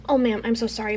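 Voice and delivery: ordinary delivery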